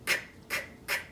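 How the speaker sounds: "k" as a voiceless, soft click of breath; clicking camera sound. Shown as a demonstration. Teacher modelling the camera sound, "k" as a bare voiceless click. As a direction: say "k" with no vowel, just the consonant. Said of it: "is only made up of one sound".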